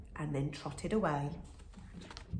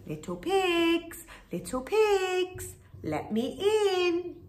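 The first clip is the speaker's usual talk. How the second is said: high slow coaxing wolf; high sing-song call; 11 semitones higher, slower than usual. A narrator's reading. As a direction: croon to the pigs. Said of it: "cried the wolf".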